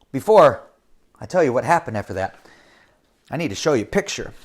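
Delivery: ordinary delivery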